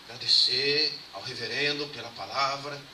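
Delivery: slow, a hint of a solemn nun; a touch of the nun's measured, slow thanks; lightly voiced nun. Nun thanking the reverend, slow and solemn. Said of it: "ela olhou pra mim assim, falou".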